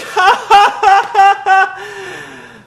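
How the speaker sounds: high, loud, hollow ha-ha-ha; fake laughter. Mocking Cole's fake laugh on commentary. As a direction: laugh on cue without meaning it. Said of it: "And Cole's like".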